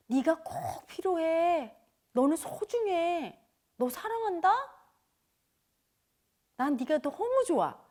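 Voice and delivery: slow, soft, loving words; tender reassurance. Acted. Teacher voicing love to the sick ego, slow and tender. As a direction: speak it gently like to a hurt child.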